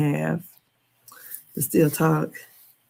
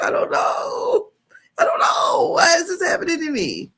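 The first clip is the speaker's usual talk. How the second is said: retold crying; 12 semitones higher, 3 dB louder than usual. Answering her worried mom, crying. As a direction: sob out the words. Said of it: "I had to literally cry".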